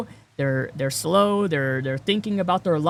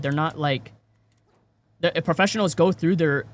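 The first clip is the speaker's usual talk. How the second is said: ordinary delivery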